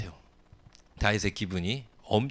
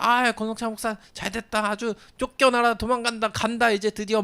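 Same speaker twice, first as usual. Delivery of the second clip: high, gleeful jeering; gleeful taunt; 10 semitones higher than usual. Retold or imitated. Heckler gleefully cheering the pastor's ouster, high jeer. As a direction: crow with delight at his fall. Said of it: "막 하면서 막 신나서 얘기하는데".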